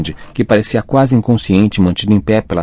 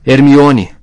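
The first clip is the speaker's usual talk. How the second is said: loud, high roar of a name; anguished roar; 6 semitones higher, 7 dB louder than usual. A narrator's reading. Rony calling to Hermione upstairs, anguished roar. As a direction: roar it out at full force. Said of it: "urrou Rony".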